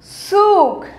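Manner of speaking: सूख with a long, firm oo; सूख, लंबा ऊ ज़ोर से; long oo, stressed. Shown as a demonstration. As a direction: stretch the oo in सूख. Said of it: "ज़ोर की बोलेंगे".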